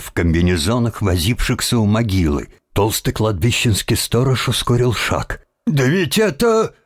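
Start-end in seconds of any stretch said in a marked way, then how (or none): 5.7-6.7 high-pitched, mildly voiced exclamation; startled cry. A narrator's reading. Watchman spotting workers at the grave, startled cry. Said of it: "на крик сторожа".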